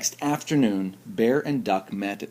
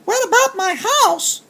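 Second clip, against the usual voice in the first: very high, squeaky little chipmunk voice; tiny chipmunk voice; 8 dB louder than usual. Acted. Chipmunk greeting Bear at the party, very high squeaky voice. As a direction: pitch way up like a small sweet chipmunk.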